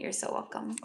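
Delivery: soft breathy whisper of thanks; a hushed, gentle you're welcome. Real, not acted. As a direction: whisper it tenderly to the viewer. Repeating thanks softly to viewer.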